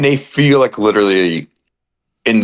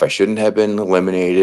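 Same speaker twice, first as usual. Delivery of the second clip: usual speaking voice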